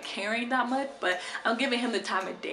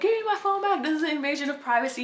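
put-on indignant boyfriend; 6 semitones higher, faster than usual. Caught boyfriend demanding phone, mock outraged. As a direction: lightly voice his outraged grab. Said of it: "And he's like".

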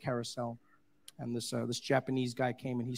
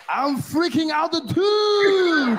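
mock freak-out; 10 semitones higher, 11 dB louder, much slower than usual. Mock panic to audience.

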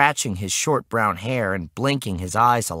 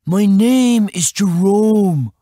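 put-on high, loud, drawn-out kid voice; put-upon high-pitched kid; exasperated boy; 7 semitones higher, 6 dB louder, slower than usual. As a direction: state it slowly as a fed-up boy. Exasperated boy gives his name.

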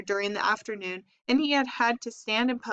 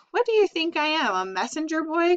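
higher, indignant rat grumble; grumbling; 7 semitones higher, 3 dB louder than usual. A narrator's reading. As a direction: grumble it up high like an offended rat. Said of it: "grumbled the rat".

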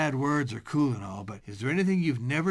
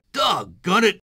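loud, higher-pitched cry of annoyance; exasperated shout; 4 semitones higher, 7 dB louder than usual. Acted. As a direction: yell it as the victim of a prank. Victim of a prank cries out.

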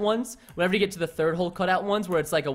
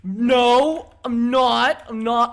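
louder, drawn-out protest; loud denial; 2 semitones higher, 5 dB louder, much slower than usual. Real, not acted. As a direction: protest it loudly. Denies being at the ladders.

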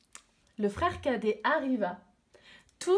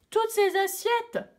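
much higher, louder whiny complaint; protesting; 11 semitones higher, 4 dB louder than usual. Acted. Little boy protesting to his mother about the dishes, whiny. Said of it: "protesta-t-il".

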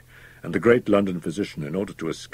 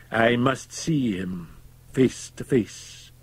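slow, higher, resolute; sudden decision; 6 semitones higher, much slower than usual. A narrator's reading. Fettes resolving to confront the doctor, slow and decided. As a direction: weigh each word with firm resolve. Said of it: "said with sudden decision".

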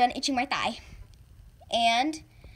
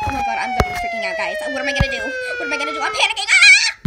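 frantic panic; 13 semitones higher, 7 dB louder, faster than usual. Acted.

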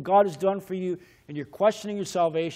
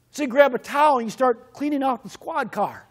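ordinary delivery

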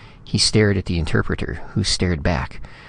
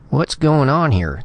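high, louder angry demand; 8 semitones higher, 3 dB louder than usual. Acted. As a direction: demand it with indignation. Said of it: "the second secretary said angrily".

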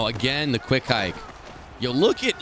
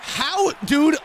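high-pitched incredulous shout; outraged high holler; disbelieving yell; 14 semitones higher, 3 dB louder than usual. Real, not acted. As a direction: cry out in exasperated disbelief. Disbelief at opponent's play.

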